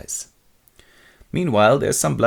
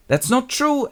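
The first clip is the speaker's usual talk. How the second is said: high-pitched wounded denial; protesting; slower than usual. Acted. Wife denies husband's accusation, high protest. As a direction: plead innocence in a high voice.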